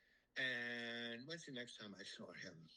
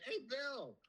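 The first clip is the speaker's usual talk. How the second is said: higher, friendly call across a lot; 12 semitones higher, 3 dB louder than usual. Retold or imitated. Greeting Bill in the shopping center parking lot, higher friendly call. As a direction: hail him as he walks by.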